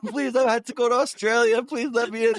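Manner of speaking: high-pitched mock plea; pleading stranded traveler. Bandmate imitating Reed at the shut gate door, mock pleading. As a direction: beg in a high, put-on voice.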